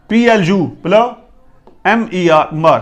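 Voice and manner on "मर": ordinary delivery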